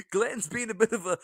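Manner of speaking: cracking up mid-imitation; real laughter breaking the mock voice; laughing through impression. Real, not acted. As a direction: try the troll voice but keep laughing. Trying to imitate troll, breaks laughing.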